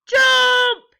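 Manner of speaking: very loud, high pirate yell; full-voiced shrieked command. A narrator's reading. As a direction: yell it as one long order. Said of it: "yelled the pirates".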